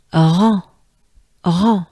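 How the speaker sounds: rend with a breathy R, nasal an; French rend. Shown as a demonstration. Teacher drills rend for students. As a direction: exhale into rend. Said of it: "good breath and exhale".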